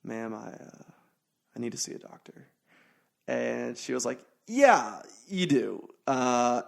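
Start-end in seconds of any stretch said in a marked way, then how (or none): 0.0-2.5 very soft, understated request; calm, retold. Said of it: "I just calmly said". 4.5-5.9 higher, louder emphatic "yeah"; emphatic mock reaction; startled desk lady. Retold desk lady's startled agreement.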